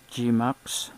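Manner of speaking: normal talking voice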